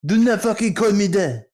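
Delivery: high-pitched mock French outrage; hammed-up anger in a thick French accent; mad Frenchman. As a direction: imitate an indignant French student losing it. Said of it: "make him really fucking mad".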